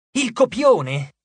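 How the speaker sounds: high, drawn-out exasperated call. A narrator's reading. Sammy looks round for the script, exasperated. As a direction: call for it slowly, like a fed-up director.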